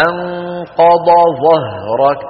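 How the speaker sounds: أنقض ظهرك drawn out very slowly, the ض and ظ kept separate; slow Quranic demo; slow recitation demo. Shown as a demonstration. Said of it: "ثم أتى بالمثال فقال".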